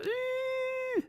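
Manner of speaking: long, very high "eh"; drawn-out high-pitched noise; high squeal. Pretend. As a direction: hold a thin high squeal, softly. Noise after reading a release.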